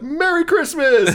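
high, slow sing-song Merry Christmas with a laugh; mock cheery greeting. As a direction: stretch it out with fake holiday cheer. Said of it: "he's like".

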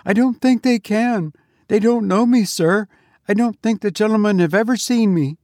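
high, anxious protesting; horror-stricken sexton. A narrator's reading. Sexton denying the goblins know him, frightened and high. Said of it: "replied the horror-stricken sexton".

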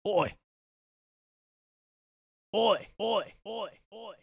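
high, hammed-up British "oi"; British accent. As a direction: holler like a cartoon Brit. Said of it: "'cause we're Brits".

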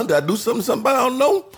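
high-pitched mock-puzzled question, climbing at the end; puzzled retort. Retelling his reply to his friend's call, high puzzled retort. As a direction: climb high into an acted 'what did I do?'.

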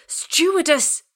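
high, full-force call for help; urgent shout. A narrator's reading. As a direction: yell it down the cabin. Passenger calls out for stewardess.

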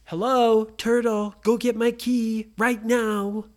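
Brady rudely ordering the turtle; bossy demand. Acted. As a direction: boss the turtle around.

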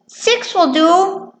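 high, slow mouse character voice; little mouse. Acted. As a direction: answer as a tiny mouse. Mouse answers Mole.

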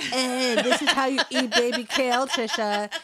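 mock bossy friend with a laugh. Friend voiced in a story.

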